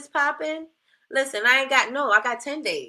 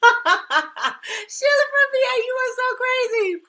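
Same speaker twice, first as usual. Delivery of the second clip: high laughing shout; laughing hard; 4 dB louder, slower than usual. Real, not acted. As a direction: laugh it out, almost crying. Laughing at a viewer's comment.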